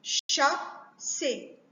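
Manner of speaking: "Chasser" slowly repeated as Cha... sser; split into syllables. Shown as a demonstration. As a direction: dictate "Chasser" in two spaced beats. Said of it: "couper les mots en syllabes".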